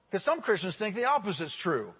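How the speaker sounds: ordinary talk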